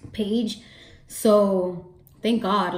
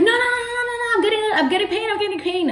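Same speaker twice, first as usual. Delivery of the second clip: very high, sing-song pleading whine; pained whine; 14 semitones higher, 4 dB louder, faster than usual. Retold or imitated. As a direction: whimper it high like a hurting child. Contraction during epidural.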